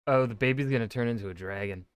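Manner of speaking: ordinary talk, nothing marked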